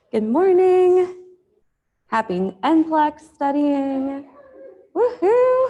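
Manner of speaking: high, loud, drawn-out cheer; whooping welcome, loud and slow; cheery class greeting. Real, not acted. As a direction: call out brightly to rally the room. Rousing greeting to open class.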